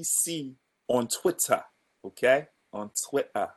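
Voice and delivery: slow mock English accent. Plugging the Melbourne social account in a playful English accent. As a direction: put on an English accent, drawn out. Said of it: "that was an English accent".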